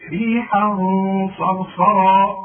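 ريحا صرصرا recited loud, tanwin hidden with nasal ghunna before the sad; تلاوة مجودة; loud tajweed recitation. Shown as a demonstration. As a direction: sound out ريحا صرصرا with a held hum. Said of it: "اسمع".

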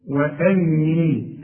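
وأني said very slowly and carefully; demo. Shown as a demonstration. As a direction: draw out وأني slowly, with each sound clear. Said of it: "اوعى تقول وأني". (نطق وأني ببطء شديد)

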